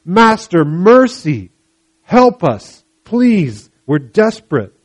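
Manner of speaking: loud, high, pleading cry; desperate cry for mercy. Acted. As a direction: beg for mercy at the top of your voice. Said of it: "crying out to Jesus for mercy".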